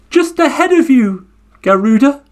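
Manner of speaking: high, smug turtle voice; cheeky turtle reply. Acted. Turtle teasing the Garuda bird again, high and smug. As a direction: tease from just ahead.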